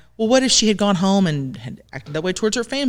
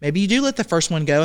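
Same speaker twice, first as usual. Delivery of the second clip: ordinary delivery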